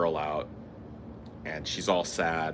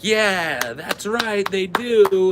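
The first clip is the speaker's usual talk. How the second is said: cheering crowd; 12 semitones higher, 6 dB louder, much slower than usual. Retold or imitated. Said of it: "the whole audience will be like".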